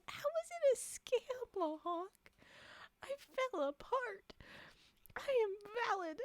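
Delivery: high-pitched, theatrical protest; mock indignation. Streamer defending herself against a scam accusation, mock-indignant.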